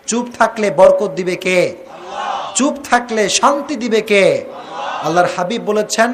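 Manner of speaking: ordinary delivery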